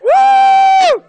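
very high, loud shouted woo; joyful whoop. Real, not acted. Preacher celebrates before the congregation with a high whoop. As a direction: let out a wild cry of joy.

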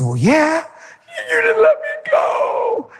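high, slow voice breaking; emotional cry. Real, not acted. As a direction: let it crack and cry out. Husband answers through emotion.

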